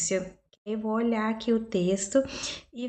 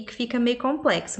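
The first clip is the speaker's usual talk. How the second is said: ordinary talk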